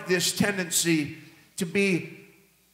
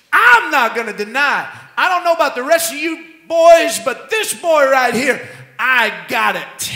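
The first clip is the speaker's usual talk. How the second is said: loud, higher, cocky boast that slows at the end; swagger; 5 semitones higher, 7 dB louder than usual. Pretend. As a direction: brag with swag to the kids. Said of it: "A little bit of swag".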